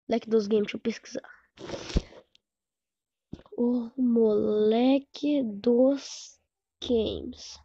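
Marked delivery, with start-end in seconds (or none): 3.6-7.6 very slow, stretched words; slow sounding-out. Real, not acted. Streamer searching for a channel, slowly sounding out its name. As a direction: draw out each word while searching.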